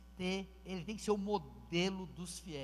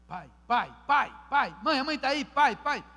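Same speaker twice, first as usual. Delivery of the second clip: mock members calling pai, louder; 6 semitones higher, 13 dB louder, slower than usual. Pastor imitating members calling him father, loud. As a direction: call out pai like eager church members.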